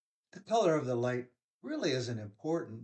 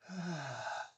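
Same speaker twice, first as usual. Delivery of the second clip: audible sigh out through the mouth; relaxing breath out; long exhale; 3 semitones higher, 5 dB quieter than usual. Shown as a demonstration. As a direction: let the breath go in a soft sigh.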